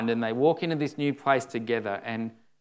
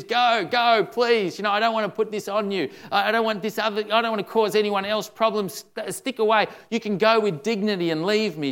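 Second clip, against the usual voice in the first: higher, louder urgent pleading; pleading Naomi; 7 semitones higher, 4 dB louder than usual. Acted. Preacher voicing Naomi begging the girls to go, urgent plea. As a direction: beg them to go, fast and pressing.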